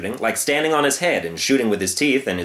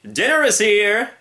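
high, louder lazy call; 10 semitones higher, 4 dB louder, much slower than usual. Acted. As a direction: call across the jungle, slow. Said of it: "called out to the young lion".

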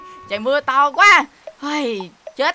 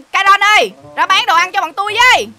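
louder customer's call; 6 semitones higher, 6 dB louder than usual. Acted. Customer calling the shop owner to sell food, calling out. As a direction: holler to summon the shopkeeper.

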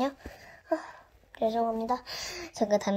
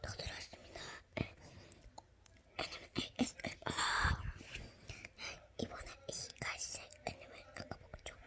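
soft whispered ASMR voice; 17 dB quieter than usual. Acted. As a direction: whisper close to the mic.

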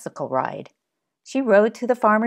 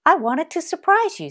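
put-on high child voice; 10 semitones higher than usual. Child confesses surprise pie entry.

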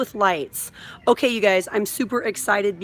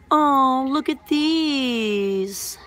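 cooing; 4 semitones higher, much slower than usual. Real, not acted. Cooing over toy outfits.

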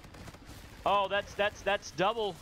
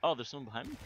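ordinary talk, nothing marked

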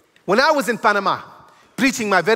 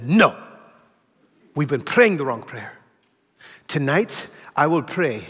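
slow, low and grave; solemn fatherly counsel; 7 semitones lower, slower than usual. Retold or imitated. Pastor father telling his son the truth, slow and grave. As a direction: lay it down gently like hard-won wisdom.

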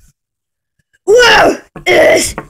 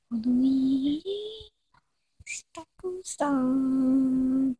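soft, slow, distant repeat; the name faintly repeated from afar; quiet echo; 16 dB quieter, slower than usual. Acted. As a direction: murmur it like a far echo. Echoed quietly.

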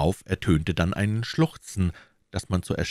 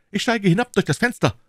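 much higher, louder call; 5 dB louder than usual. A narrator's reading. D'Artagnan calling to his servant as he leaps out, loud. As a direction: announce it boldly as he goes. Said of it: "rief D'Artagnan".

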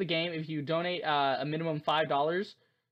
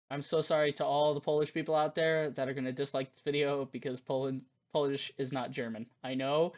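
ordinary talk, nothing marked

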